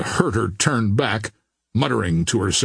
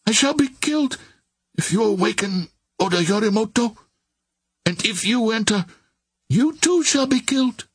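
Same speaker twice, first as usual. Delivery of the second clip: high, frightened and desperate; desperate pleading; 10 semitones higher than usual. A narrator's reading. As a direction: plead in terror through the door. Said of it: "she cried".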